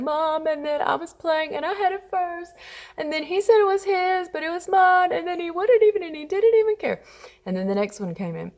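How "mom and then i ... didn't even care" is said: high, whiny mock kid complaining to mom; crying child tattling. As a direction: wail it out like an upset little kid. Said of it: "They're laying there crying".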